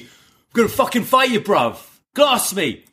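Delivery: put-on London accent, pitched well up and aggressive; rough London accent. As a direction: threaten like a London street brawler. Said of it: "they ain't gonna talk like that".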